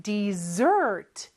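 Dessert with the stress on the end; stress on the second syllable. Shown as a demonstration. Teacher shows where Dessert is stressed. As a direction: put the weight on the last part of Dessert. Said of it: "뒤에 강세가 있고요".

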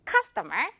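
CUSTOMER with its first syllable strongest; stress on CUS. Shown as a demonstration. Teacher giving the correct word again after mistakes, "customer" stressed on CUS. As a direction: hit CUS and lighten the rest of "customer". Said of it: "cus 부분이 가장 강해야".

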